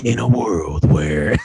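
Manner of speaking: slow, low epic announcer; movie trailer voice. Pretend. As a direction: open like a blockbuster trailer. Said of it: "the movie trailer guy".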